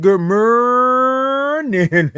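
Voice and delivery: morning as a long held "murrr-nin"; U's in morning. Retold or imitated. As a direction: stretch "morning" into a high, slow "muurning". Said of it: "you put all the U's in there".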